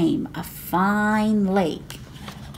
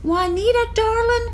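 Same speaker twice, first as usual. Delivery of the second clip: teasing mother; 14 semitones higher, faster than usual. A narrator's reading. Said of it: "said Ma".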